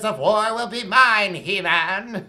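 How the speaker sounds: villain voice. Pretend. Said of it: "The skull is just like".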